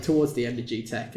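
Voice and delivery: usual speaking voice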